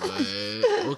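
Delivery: very high, loud squeak; mock incredulity. Player reacting to being told a rival is better, mock incredulity. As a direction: shoot the pitch way up in disbelief. Acts own reaction to roasting.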